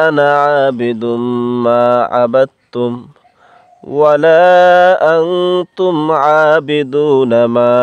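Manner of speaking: Quran recitation. Acted. Teacher reciting the surah for students, slow measured chant. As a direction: intone each phrase with long held vowels.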